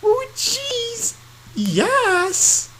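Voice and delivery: squeaky high cartoon bubble voice; high squeaky cartoon girl. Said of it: "my bubble voice is terrible".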